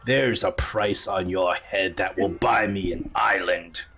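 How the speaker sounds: low, slow menace; threatening admiral. Acted. Admiral threatening his freed prisoner, low and menacing. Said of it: "and he says".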